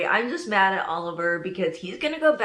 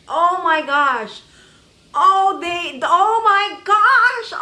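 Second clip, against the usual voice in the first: high, loud, drawn-out astonishment; loud fan freak-out; overwhelmed excitement; 7 semitones higher, 7 dB louder, slower than usual. Real, not acted. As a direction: gush in stunned disbelief. Overwhelmed reaction to crossover ending.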